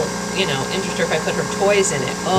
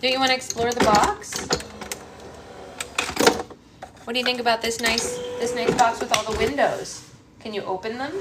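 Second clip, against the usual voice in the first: higher, sing-song coaxing; 4 semitones higher than usual. Real, not acted. Mom coaxing baby to box.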